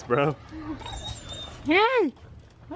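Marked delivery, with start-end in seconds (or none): 1.6-2.7 high goofy dog voice; squeaky cartoon dog calling out. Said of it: "that's a dog voice".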